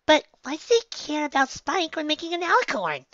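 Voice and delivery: squeaky high-pitched curious mare; Pinkie voice. A narrator's reading. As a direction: ask like a bubbly pink pony, very high.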